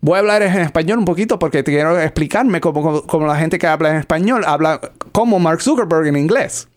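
fast, running Spanish demonstration; rapid Spanish. Shown as a demonstration. Translating to show Spanish sounds normal this way, rapid even delivery. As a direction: rattle it off quickly in Spanish. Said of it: "that sounds perfectly normal in Spanish".

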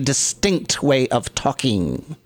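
slow, over-enunciated theatrical diction; perfect diction. Shown as a demonstration. Imitating diction-trained actors, slow perfect enunciation. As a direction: pronounce every word with deliberate stage precision. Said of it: "words get enunciated perfectly".

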